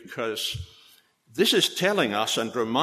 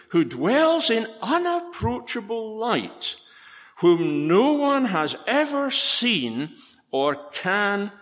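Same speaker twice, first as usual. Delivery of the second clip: exalted scripture reading; 5 semitones higher, 3 dB louder than usual. Acted. Preacher proclaiming Paul's praise of God to the church, rising and exalted. Reads First Timothy doxology.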